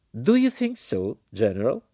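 very high, drawn-out demand; challenging question. A narrator's reading. American woman turning abruptly to the general, challenging. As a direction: put him sharply on the spot.

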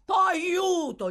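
loud, high imitation of a cry; mocking echo. A narrator's reading. The man echoes Oliver's plea, mockingly. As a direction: throw the boy's plea back at him. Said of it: "gli fece eco quest'ultimo".

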